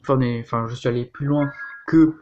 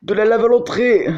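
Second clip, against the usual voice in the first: raging gamer; 6 semitones higher, 5 dB louder than usual. Real, not acted. Gamer exclaiming about lava.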